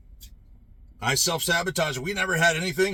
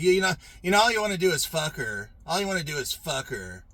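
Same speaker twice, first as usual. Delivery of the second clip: ordinary talk, nothing marked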